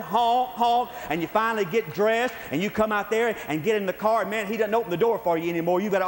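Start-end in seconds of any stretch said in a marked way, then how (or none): 0.0-0.9 higher, punchy honking; imitated car horn. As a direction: honk like an impatient driver leaning on the horn. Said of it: "He starts beating on the horn".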